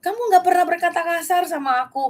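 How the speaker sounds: high-pitched, insistent retold reassurance; earnest protest. Husband answers wife's apology, high and insistent in protest. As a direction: push back on the apology, pitched well up.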